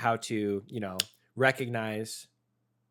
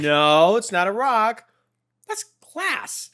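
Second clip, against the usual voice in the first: parrot voice; 9 semitones higher, 9 dB louder, slower than usual. Retold or imitated. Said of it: "It's like".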